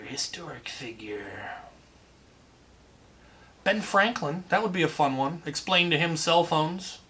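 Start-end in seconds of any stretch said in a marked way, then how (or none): 0.0-1.5 whispered to himself; slow whisper, thinking aloud. Real, not acted. Repeating question to himself, whispered.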